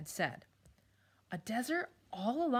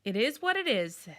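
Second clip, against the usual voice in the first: loud, higher scolding owl; bossy owl barking orders; stern owl; 4 semitones higher, 7 dB louder than usual. Acted. Owl lecturing them.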